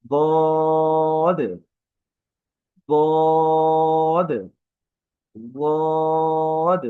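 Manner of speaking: "বদ" as Arabic Dad, long held high o; intoned, drawn-out "বদ" with a heavy d; tongue edge on gums. Shown as a demonstration. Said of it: "দাঁতের মাড়ির সাথে লাগিয়ে হরফটা".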